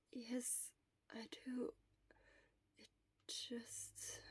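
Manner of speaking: hoarse sick whisper, barely any voice; Shota's voice. Acted. Shota whispering weakly to his friend from the sickbed. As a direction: whisper it like a sick man worn out.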